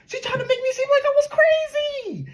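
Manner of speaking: very high, whiny mock crying voice; mocking the victim act. Mocking his girlfriend playing the victim, high whiny fake crying. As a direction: squeak it out like a fake weeping girlfriend.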